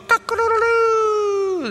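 high crowing rooster imitation. Preacher imitates the rooster crowing, high crow.

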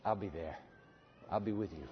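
tender low reassurance; soft and low. Acted. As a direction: drop down to a hush. God's promise, dropping soft.

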